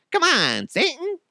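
high coaxing call to Satan; coaxing Saddam. Retold or imitated. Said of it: "see if Satan will have".

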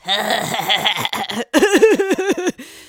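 imitated long, stuttering laugh; J D's laugh. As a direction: imitate a drawn-out run of laughter. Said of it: "thinking of J D's laugh".

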